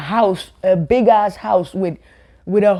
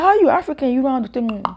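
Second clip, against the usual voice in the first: mocking classmate; 7 semitones higher than usual. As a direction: taunt her like a bully. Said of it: "They're like".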